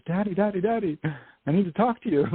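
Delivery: put-on little girl calling. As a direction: call for daddy like an eager child. Little girl runs in calling daddy.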